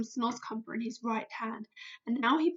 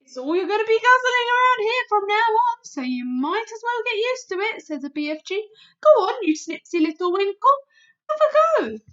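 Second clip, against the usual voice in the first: higher, louder giant declaring; BFG, insistent; 9 semitones higher, 10 dB louder than usual. Acted. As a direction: proclaim it as a giant. BFG voice in story.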